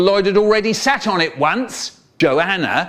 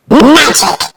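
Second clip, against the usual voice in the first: sudden shout; 12 semitones higher, 13 dB louder than usual. Acted. Inspector's outburst in story.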